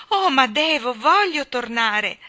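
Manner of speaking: loud, high plea, drawn out; child's distressed cry. Acted. Jane begging to return home, crying out. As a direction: cry out, desperate to go back. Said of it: "gridò Jane".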